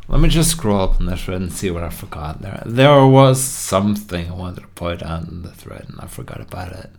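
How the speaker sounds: normal talking voice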